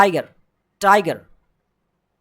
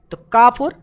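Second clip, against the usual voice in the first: ordinary delivery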